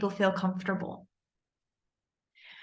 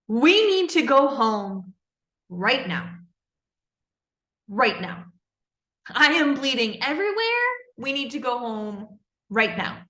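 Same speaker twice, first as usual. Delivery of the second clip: urgent outburst; 3 semitones higher than usual. Retold or imitated. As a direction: retell it as an upset outburst, punching each demand. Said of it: "this outburst".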